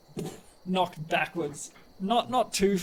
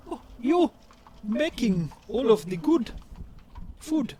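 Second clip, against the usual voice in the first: touched Sugo; 3 semitones higher, 4 dB louder than usual. Acted. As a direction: sigh it out, moved by home cooking. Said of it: "And Sugo sort of".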